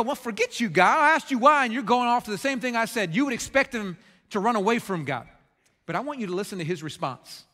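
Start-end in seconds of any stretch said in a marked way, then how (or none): 0.0-3.1 angry retort, higher and louder; imagined outburst of indignant protest; Job. Pretend. Imagined Job snaps back at God for not answering, angrily. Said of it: "you would expect Job to be angry".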